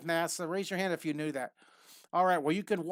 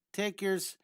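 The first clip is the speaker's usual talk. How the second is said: ordinary delivery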